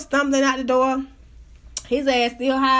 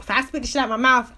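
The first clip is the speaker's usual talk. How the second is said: ordinary delivery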